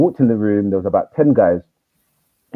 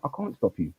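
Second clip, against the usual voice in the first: calm; 12 dB quieter than usual. Retold or imitated. Teacher calmly concedes.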